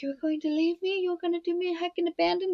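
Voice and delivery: put-on high, fast, babyish whine of betrayal; whiny hurt friend. [name] whining to Alice she's being abandoned, high babyish whine. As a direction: pout it out like an abandoned child.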